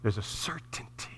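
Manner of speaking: soft, near-whispered conviction; hushed assurance. Real, not acted. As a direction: drop almost to a whisper for weight. Hushed point in closing appeal.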